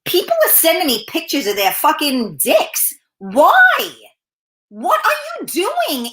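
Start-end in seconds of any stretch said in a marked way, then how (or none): 3.2-6.1 high, slow disbelieving question; incredulous. Acted. To men sending pictures, high incredulous disbelief. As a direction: ask it like you can't believe it. Ranting at unsolicited senders.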